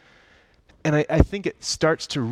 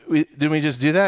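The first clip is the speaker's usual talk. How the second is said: mock-puzzled higher, louder question; puzzled protest; 5 semitones higher, 5 dB louder than usual. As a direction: blurt it out as a baffled objection. Puzzled Adam and Eve protest.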